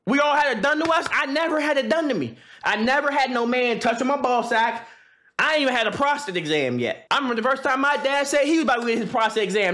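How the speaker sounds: normal talking voice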